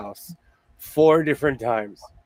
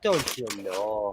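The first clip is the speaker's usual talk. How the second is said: low, slow, soft Canadian lilt; mock Canadian accent; 7 semitones lower, 7 dB quieter, slower than usual. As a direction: stretch it out in a put-on Canadian accent. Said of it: "oh, Canadian".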